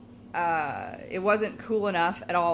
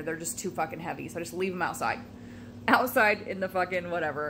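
ordinary delivery

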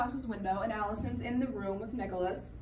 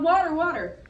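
a touch louder, not screamed; a toned-down recap of the yell; mild retold yell; 4 semitones higher, 7 dB louder than usual. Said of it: "but he yelled".

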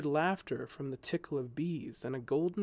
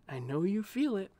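higher, gently insistent; insisting; 6 semitones higher than usual. A narrator's reading. She coaxes her dejected friend, insisting. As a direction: press him to feel it too. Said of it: "she insisted".

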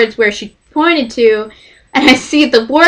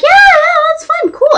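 high mock agreement. Quoting Kurt agreeing.

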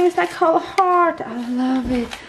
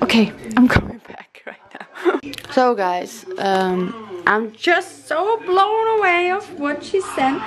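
usual speaking voice

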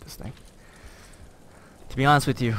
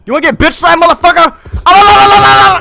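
high, loud mocking taunt; taunting scream; 17 dB louder than usual. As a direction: scream a sing-song taunt. Trash-talk taunt.

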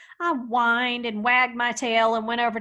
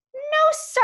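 much higher, louder, fired-up telling; defiant exclamation; 6 dB louder than usual. A narrator's reading. Hank telling how he barked back, excited and high. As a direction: burst out with pride.

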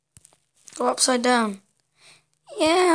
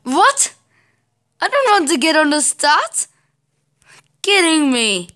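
higher, louder disbelief; frustrated outcry; 4 semitones higher, 5 dB louder than usual. Real, not acted. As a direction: cry out in disbelief. Sent back to the start.

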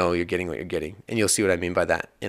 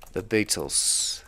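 put-on terrible British accent; 3 dB quieter, much slower than usual. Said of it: "probably a terrible accent".

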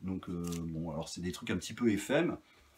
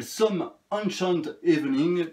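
clumsy English accent; 7 semitones higher, 5 dB louder, slower than usual. Real, not acted. Reading the album title to viewers in a poor English accent. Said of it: "mon accent anglais est toujours aussi pourri".

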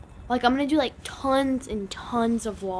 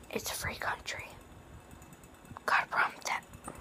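hushed whisper, barely voiced; 12 dB quieter than usual. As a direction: drop to a secretive whisper. Whispered joke aside.

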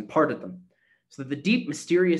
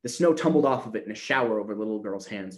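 ordinary delivery, nothing marked

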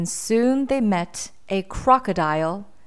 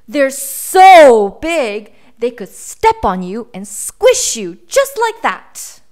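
hammed-up scary warning; dramatic scare; 4 semitones higher, 11 dB louder than usual. A narrator's reading. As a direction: draw it out to frighten the listeners. Boy scaring animals.

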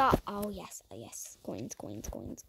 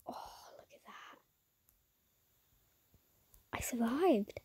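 whisper; 10 dB quieter than usual. Real, not acted.

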